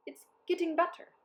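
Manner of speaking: high, pleased pronouncement; a sly, satisfied note; declared verdict. A narrator's reading. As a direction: tempt them with progress. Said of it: "he declared".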